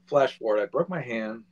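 usual speaking voice

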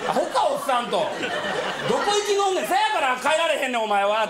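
put-on laughing while scolding the old man.

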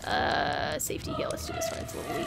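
soft high whispered mutter; quiet worried whisper; hushed. Real, not acted. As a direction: murmur to yourself nervously. Hushed muttering while healing.

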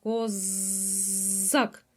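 ordinary delivery

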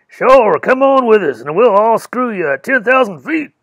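loud, casual joking lumberjack. Acted. Chafee inviting the girl up the mountain, loud lumberjack joke. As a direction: tease her in a big hearty lumberjack boom. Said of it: "a big, loud, deep imitation of a lumberjack".

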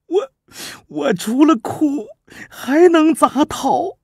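tearful. Acted. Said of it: "被苏秦说的伤心，泪水就流了出来".

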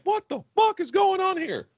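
high-pitched incredulous outburst; retold disbelief. Bystander at the beach reacts to shouting biker, incredulous. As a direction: act out being stunned, pitch jumping right up.

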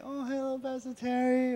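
high, soft mock-sweet hello; sweet greeting. Pastor imitating churchgoers greeting him sweetly in the hallway. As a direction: coo a lightly voiced sweet hello. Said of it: "many of you are like".

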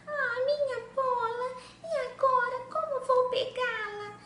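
high, whiny princess voice; a pampered princess whining over her lost ball; upset princess. Acted.

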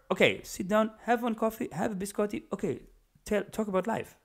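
high, soft mock Italian-Middle Eastern accent. European coaxing a worker to take coffee, Italian-Middle Eastern accent. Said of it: "I kinda make my Italian Middle Eastern".

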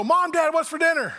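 put-on high, loud, fast kid's call; kid calling parents. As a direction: call out like a hungry child. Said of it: "kids walk into the kitchen and go".

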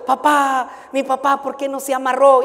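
lightly voiced crying son, pleading; retold tearful cry. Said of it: "el hijo del hermano llorando".

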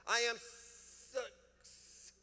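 drawn out, stalling before sorry; prideful stammer. Retold or imitated. Pastor mimicking someone too proud to apologize, stalling slowly. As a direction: stretch it slowly and choke off the word. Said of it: "What, what, what do you mean?".

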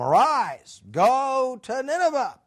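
slow, lifted divine command; voice of the Lord. Retold or imitated. The Lord sending Jonah to Nineveh, slow and commanding. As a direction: call him up slowly and grandly. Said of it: "the word of the Lord came".

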